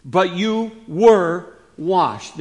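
WERE drawn out, set apart and strong; slow right down to land on "were"; "were" leaned on, then a beat before the next word; stress on were. Shown as a demonstration. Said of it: "when it says "were," it's past completed action".

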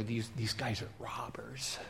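hushed robber whisper behind a rock. Acted. Robber whispering to his partner behind a rock. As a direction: whisper it like you're hiding.

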